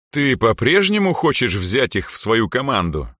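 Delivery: usual speaking voice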